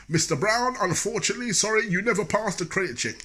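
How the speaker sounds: high, loud apology blared across the shop; shouting clerk. Retold or imitated. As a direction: announce the bad news loud for everyone to hear. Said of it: "he was always shouting".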